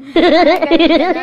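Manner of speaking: loud laugh; real laughter. Real, not acted.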